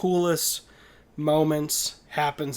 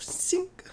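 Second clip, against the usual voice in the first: metallic "shing" of a drawn knife; imitated blade noise. Podcaster mocking the film's unrealistic knife noise, imitated blade sound. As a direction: mimic a movie knife sliding out. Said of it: "not gonna happen in real life noise".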